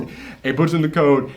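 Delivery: ordinary delivery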